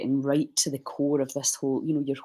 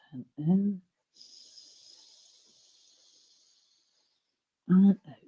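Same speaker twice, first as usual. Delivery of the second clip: guided breathing cues; 4 semitones higher, 5 dB quieter, slower than usual. Acted. Leads breathing rounds.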